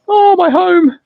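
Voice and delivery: light up at hearing home; surprised joy; delighted surprise. Real, not acted. Viewer from Australia.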